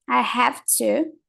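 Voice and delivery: ordinary talk, nothing marked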